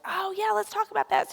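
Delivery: high-pitched imitated enthusiasm; light, high quoted reply; eager agreement. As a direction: jump up high, welcoming the chat. Voices the speaker's eager agreement.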